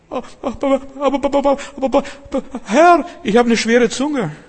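high, loud, stammering whine; stuttering Moses. Acted. Preacher voicing Moses stammering his excuse to God in a high whine. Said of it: "Dann fängt er an zu stottern".

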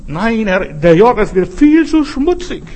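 high, loud, scornful refusal; disdainful Naaman. Acted. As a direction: sneer at the dirty river like a proud general. Naaman scoffing at the Jordan. (hochnäsig und verächtlich, höher und lauter)

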